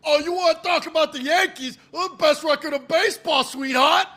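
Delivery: loud, high-pitched New York loudmouth; hammed-up New York heckler with attitude; New York accent. Mocking a sleazy Yankees fan to the co-host, hammed-up New York accent. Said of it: "I hate your New York accent".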